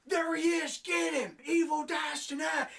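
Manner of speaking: high, loud battle cry; rally the mob to charge; mock mob shout. Negan leading the mob at the inmate, shouting. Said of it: "he's like".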